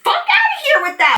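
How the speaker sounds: sudden high shout; outraged yell. Retold or imitated. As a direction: blast it out in disbelief. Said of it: "And I was like".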